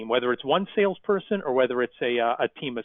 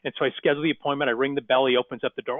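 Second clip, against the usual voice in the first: ordinary talk, nothing marked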